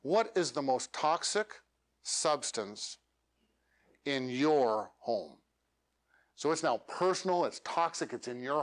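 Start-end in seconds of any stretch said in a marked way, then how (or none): 0.0-5.4 slow, with a break before substance; slow, paused, lower-toned question; pausing out the question. Shown as a demonstration. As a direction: space out each phrase of the question. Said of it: "where I'm even pausing out the question".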